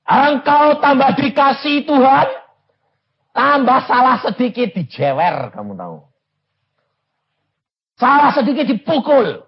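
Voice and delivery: high, loud pulpit shout; fiery sermon call; preaching at full force. Acted. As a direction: thunder it at the congregation. Preacher shouting about discipline.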